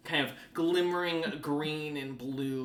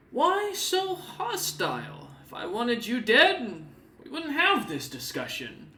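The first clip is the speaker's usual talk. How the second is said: high, slow, mocking purr; a smug host brushing off a threat; Snowbeard, amused; 11 semitones higher, 5 dB louder than usual. The demon teases the armed party, high and amused. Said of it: "looks over at you and then goes".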